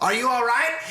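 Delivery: rushed-at-her high, urgent question; Loomis. Retold or imitated. Loomis asking a woman if she's okay, frantic impression. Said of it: "I'm gonna do my Loomis impression".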